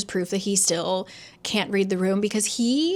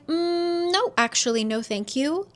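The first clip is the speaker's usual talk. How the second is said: polite refusal; 8 semitones higher than usual. Retold or imitated. Jovi turns down the alliance.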